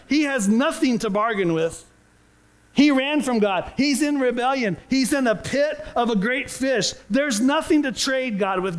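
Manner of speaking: ordinary talk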